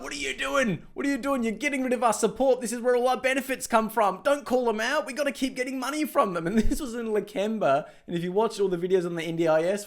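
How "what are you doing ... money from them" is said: higher, panicky mock crowd voice; mock outrage from the protesters. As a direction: voice the supporters fretting over lost money. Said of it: "They're like".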